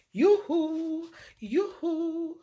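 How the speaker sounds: high, loud sing-song call; cheery hello through the door; 1 calling yoo-hoo. A narrator's reading. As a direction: sing it out from the doorstep.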